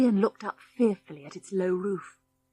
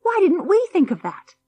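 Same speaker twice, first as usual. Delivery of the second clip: excited; 10 semitones higher, 5 dB louder than usual. A narrator's reading. Eager realisation, very high.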